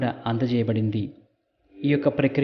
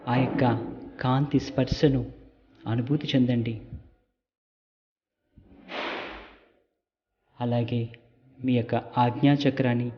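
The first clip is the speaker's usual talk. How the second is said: calm meditation guide with long pauses; guided meditation. Real, not acted. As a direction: lead them gently, phrase by phrase, leaving silences. Leads a light meditation. (నెమ్మదిగా, విరామాలతో ధ్యాన మార్గదర్శక స్వరం)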